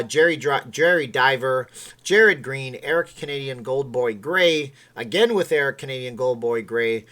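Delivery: loud, punchy roll call of names; hyped-up credits read; announcer shout-out. Real, not acted. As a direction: boom out each name like an announcer. Reads out supporters' names as announcer.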